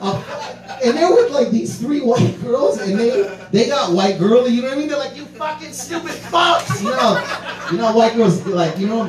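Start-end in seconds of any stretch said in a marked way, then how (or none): 5.2-6.8 put-on high-pitched outraged girl's shout; mock scolding. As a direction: imitate an indignant girl yelling abuse.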